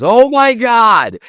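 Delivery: high, loud, drawn-out mock horror; mortified exclamation. Husband realising his wife's mistake, mortified exclamation. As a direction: act out a horrified, stretched-out gasp of recognition.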